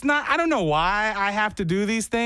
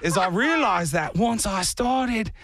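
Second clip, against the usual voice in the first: fake Australian accent; 4 semitones higher than usual. Comic can't stop once started, hammed-up Australian accent. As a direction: narrate in a bogus Australian accent. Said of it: "you're slipping on your accent".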